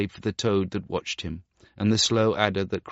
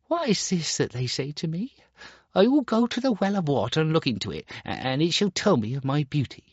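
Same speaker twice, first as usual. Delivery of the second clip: much higher, quick wondering; puzzled boy; 9 semitones higher than usual. Acted. Star Child wonders to himself, puzzled. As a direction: puzzle over it aloud.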